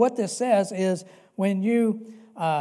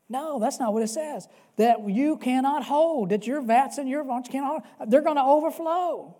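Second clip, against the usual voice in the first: higher and louder, swelling up; building preaching; 6 semitones higher, 4 dB louder than usual. Real, not acted. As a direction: build to a shout of overflow.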